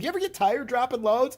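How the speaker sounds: mock innocent question. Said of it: "I'm like".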